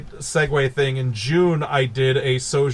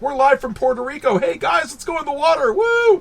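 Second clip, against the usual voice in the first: much higher, hyped party host call; spring break hype; 11 semitones higher than usual. Retold or imitated. Mocking the movie's spring break scene, high hyped whoop. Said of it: "spring break style thing".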